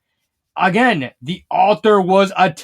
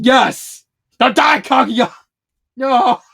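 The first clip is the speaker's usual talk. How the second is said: louder, higher hammed-up cry; mock dramatic plea; 6 semitones higher, 5 dB louder, slower than usual. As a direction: ham up a desperate plea. Character begs Kaguya dramatically.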